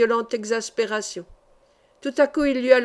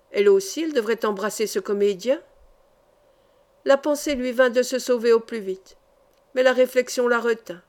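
plain reading voice

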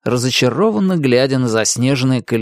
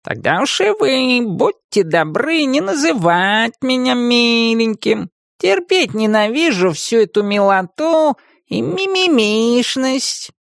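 haughty cat; 11 semitones higher, slower than usual. Acted. Talking cat's voice.

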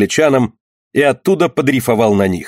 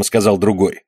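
ordinary delivery, nothing marked

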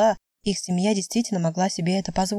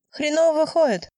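put-on higher-pitched, louder jab from a pushy young skater; pushy girl, voice pitched up; brash girl's comment; 8 semitones higher, 4 dB louder than usual. A narrator's reading. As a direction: toss out a cheeky criticism in a high voice. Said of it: "прокомментировала темнокожая девушка".

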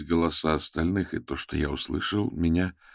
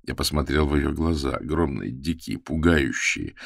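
ordinary delivery

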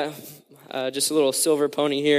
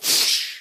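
mouth-made whoosh of water; rushing water noise. Retold or imitated. Preacher shows the sea closing, whooshing water noise. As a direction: imitate water crashing down in one hiss. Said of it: "the water goes".

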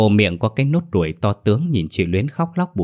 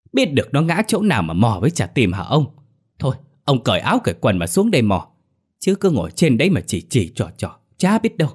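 irritated, higher-pitched telling-off; scolding retort; 5 semitones higher than usual. Acted. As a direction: snap back at an onlooker who keeps giving orders. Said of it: "nói lại như quát".

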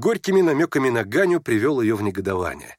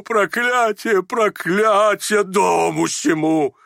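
put-on overwrought old general; 7 semitones higher, slower than usual. A narrator's reading. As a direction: bless fervently, then wail the curse. General blesses, then curses. (надрывное благословение, переходящее в протяжное проклятие)

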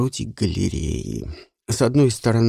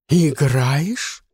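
surprised; 4 semitones higher than usual. Acted. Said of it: "не пыталась скрыть своего удивления".

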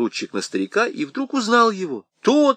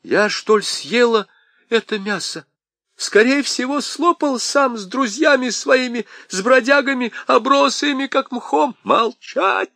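high indignant scolding; 10 semitones higher than usual. A narrator's reading. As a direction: scold him back, raised voice. Wife scolds husband back.

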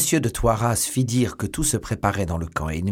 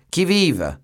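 a loud, firm challenge in the night, higher; firm shout; 8 semitones higher, 5 dB louder than usual. A narrator's reading. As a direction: call out sharply like a sentry. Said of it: "cria de sa voix ferme".

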